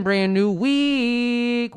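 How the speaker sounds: loud, high announcer hype; hype intro. Acted. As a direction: open the show big and loud.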